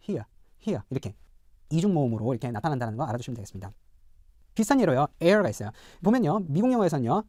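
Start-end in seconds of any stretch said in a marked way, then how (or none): 0.1-0.8 British here as "hee-uh", no R; British non-rhotic. Shown as a demonstration. Teacher recaps for learners, British R-less here. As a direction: glide here into a schwa, British style. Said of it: "영국 표준 영어에서는".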